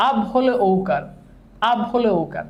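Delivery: level, measured rule chanting with pauses between repeats; repeat-after-me drill. Shown as a demonstration. Teacher leading students who repeat the vowel rules, chanted drill. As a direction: recite it evenly for a class to echo. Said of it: "আমার সাথে সাথে বলেন".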